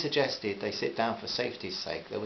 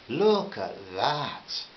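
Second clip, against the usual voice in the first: drawn-out vowels; 2 semitones higher, 4 dB louder, much slower than usual. A narrator's reading. Pleased man pointing at the sister ferry, vowels drawn out. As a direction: Look at that, every vowel stretched long and slow. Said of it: "He drew out the vowels".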